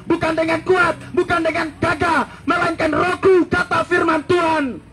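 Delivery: high, ringing proclamation, slowed on key words; fiery preaching. Acted. Preacher proclaiming God's word, high fiery preaching.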